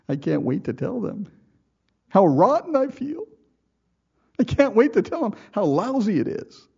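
high, whiny mock griper's voice, rising as it goes; mock complainer. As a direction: play the eager grumbler savoring his gripes. Mocking an eager complainer.

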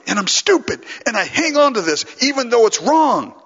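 high, emphatic appeal in separate bursts; pleading with God. Retold or imitated. Preacher re-enacting his prayer to the Lord, urgent high plea. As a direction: beg to be overruled, voice lifted. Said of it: "I've had to tell the Lord".

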